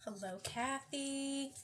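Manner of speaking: usual speaking voice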